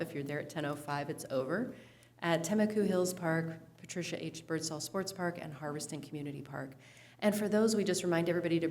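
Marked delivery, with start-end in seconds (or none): none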